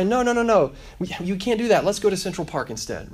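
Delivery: acted loving parental no; protective refusal. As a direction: cut in to stop them, then redirect. Acted parent stopping his child.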